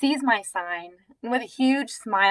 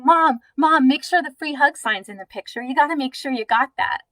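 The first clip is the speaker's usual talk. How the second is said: put-on higher, quick, eager kids pestering Mom; insistent young girls; 5 semitones higher, 5 dB louder than usual. Girls pleading with Mom about the photo, imitated insistent kids. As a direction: imitate excited girls urgently nagging their mother. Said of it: "And the girls are saying".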